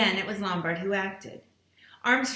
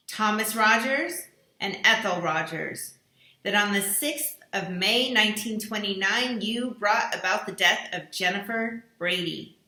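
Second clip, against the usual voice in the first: accusing recorded voice; 5 dB louder than usual. Acted. Mysterious voice accusing each dinner guest, stern and solemn. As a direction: pronounce the charges like a judge's sentence.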